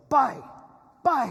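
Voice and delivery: high, loud imitated yell of an angry owner. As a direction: shout it sharply like shooing a stray dog. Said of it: "And he yells".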